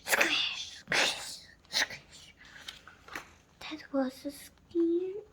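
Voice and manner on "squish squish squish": whispered wet squish sounds; squishy footsteps. A narrator's reading. Dragon walking on wet leaves, whispered squishes. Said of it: "feet went squish".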